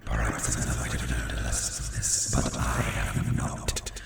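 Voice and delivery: very low, slow raspy growl; ominous demon statue. Acted. Demon statue to the one who hears it, ominous. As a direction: speak as an ancient, breathy demon.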